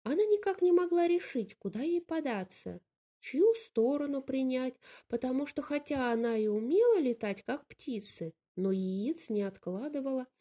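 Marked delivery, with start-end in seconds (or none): none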